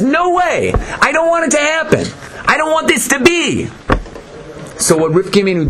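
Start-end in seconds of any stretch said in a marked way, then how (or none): 0.0-3.7 high-pitched, insistent mock protest; complaining. Satan protesting an open revelation, complaining in a high voice. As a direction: object strenuously, voice pitched way up. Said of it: "He complains".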